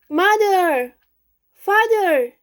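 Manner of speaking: high, loud calls into the forest; shouting for parents. Acted. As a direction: call out for lost parents. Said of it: "I shouted".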